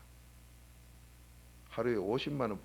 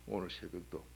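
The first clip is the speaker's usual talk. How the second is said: ordinary talk, nothing marked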